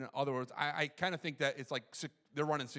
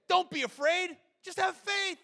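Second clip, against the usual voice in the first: high, loud acted encouragement; loud, pitched-up preacher quoting Jesus; emphatic reassurance; 14 semitones higher, 9 dB louder, slower than usual. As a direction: call it out as a bold command. Acts Jesus urging Jairus.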